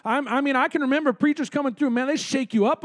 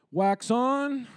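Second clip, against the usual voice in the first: softer, drawn out wax on like a karate coach; coach demo; 4 dB quieter than usual. Shown as a demonstration. Pastor leading the congregation in a drill, coaching voice. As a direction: show the move and say it calmly. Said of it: "put it up like this".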